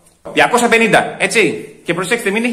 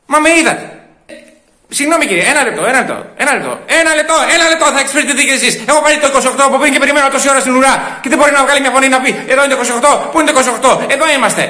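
high, loud indignant rant; shouting; 8 semitones higher, 4 dB louder, faster than usual. Acted. Customer defending his place in the queue, shouting. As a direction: argue over everyone, voice raised and heated. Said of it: "Δεν φωνάζω, δεν φωνάζω".

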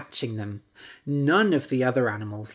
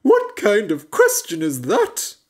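loud, higher indignant horse; gruff horse; 6 semitones higher, 7 dB louder than usual. A narrator's reading. Horse scoffing at the frog's question, loud. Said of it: "said the horse".

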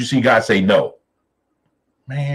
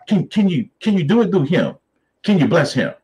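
pleading Abraham; 8 semitones higher than usual. Retold or imitated. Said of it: "And then he goes".

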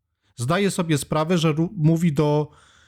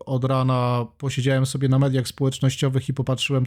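ordinary delivery

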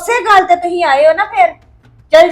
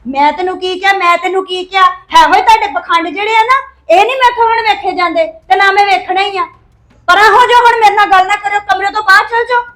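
angry shouting; 4 semitones higher, 4 dB louder than usual. Acted. Wife shouts at husband.

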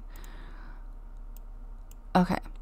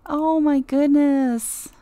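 slow, louder gushing sigh; 4 semitones higher, 6 dB louder, slower than usual. Real, not acted. Cooing at the pet.

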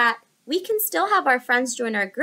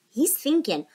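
ordinary delivery, nothing marked

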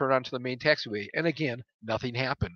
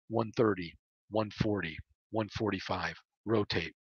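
low, hushed count with pauses between; focused speed callouts; 5 semitones lower, 8 dB quieter than usual. A narrator's reading. Pilot calling speeds to himself on takeoff, low and quiet. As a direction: call each number softly and evenly.

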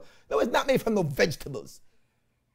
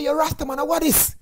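high mock disbelief, higher pitch; scoffing at brother's almond milk, high and mocking; mock incredulous; 10 semitones higher than usual. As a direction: play the shocked younger brother, pitched up. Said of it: "I don't say".

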